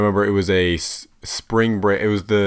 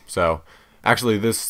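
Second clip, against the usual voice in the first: ordinary delivery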